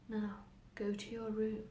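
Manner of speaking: soft, slow command; hushed inner voice. Acted. Voices in her head send the girl away, hushed. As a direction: quietly draw out the order.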